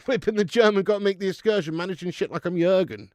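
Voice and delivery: mock rap in a Scouse accent. Streamer improvising football parody lyrics for chat, hammed-up Scouse. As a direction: rattle off parody bars in Scouse. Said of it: "Do some [name], but in Scouse".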